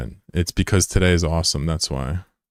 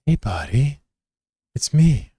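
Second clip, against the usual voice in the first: silly greeting voice; 7 semitones higher, slower than usual. Acted. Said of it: "don't know why I'm talking like that".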